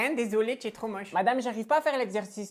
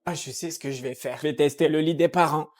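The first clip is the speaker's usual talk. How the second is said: usual speaking voice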